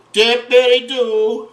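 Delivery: slow, high, playful "Dippity-doo"; drawn-out playful. Pretend. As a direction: sing out "Dippity-doo", syllable by syllable. Playful repeat while dipping the fish.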